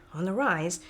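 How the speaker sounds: ordinary delivery, nothing marked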